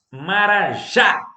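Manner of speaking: Marajá slowed, final JÁ loud and high; stress on last syllable. Shown as a demonstration. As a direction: draw out Marajá and punch the JÁ. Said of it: "Sílaba tônica, última". (Marajá devagar, com força no JÁ final)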